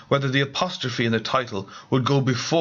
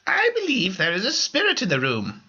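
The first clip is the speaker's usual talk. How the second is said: high, solemn medium sensing a presence; spiritual medium; 9 semitones higher than usual. Acted. Medium addressing the residents, solemn high voice. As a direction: announce the spirit with theatrical gravity.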